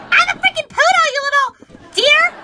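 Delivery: high, loud outburst of offence; indignant yell. Acted. Poodle correcting guest who called her sheep, indignant yell. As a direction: yell it, thoroughly insulted.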